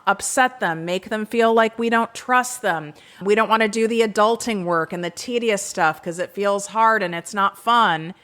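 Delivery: ordinary delivery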